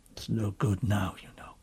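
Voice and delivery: low, mildly sorrowful faun. Acted. Faun telling Lucy it is too late, sorrowfully. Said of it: "shaking its head at her very sorrowfully".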